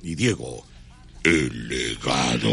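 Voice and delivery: very low, slow announcer voice; stage announcer. Retold or imitated. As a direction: draw out the band name deep and slow. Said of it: "Y cuando anuncian".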